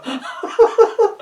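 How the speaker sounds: high giggling fit, can't stop; a high squealing laugh breaking through; helpless high laugh. Real, not acted. Reading a silly story to viewers, laughing helplessly.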